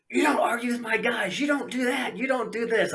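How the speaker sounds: high, scolding mock-boss voice; angry track boss. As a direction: imitate an irate boss laying down rules.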